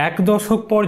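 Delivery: plain reading voice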